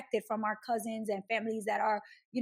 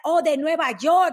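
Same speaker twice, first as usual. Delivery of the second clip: much higher, louder, slow awed exclamation; impressed relatives; 5 semitones higher, 9 dB louder than usual. Retold or imitated. Dominican relatives greeting the visiting family, loud, high and awed. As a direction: gush over someone from New York. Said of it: "it's like".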